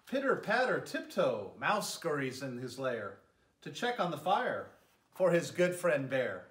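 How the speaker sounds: gentle, lilting read-aloud voice; storybook narration. A narrator's reading. Mayor reading a bedtime story to children, lilting narration. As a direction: tell it like a bedtime story for little ones. Reads picture book to children.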